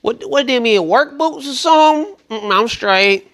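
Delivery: high-pitched mock picky woman; dismissive woman rejecting suitor. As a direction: put on a high voice, brushing him off. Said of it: "when you said".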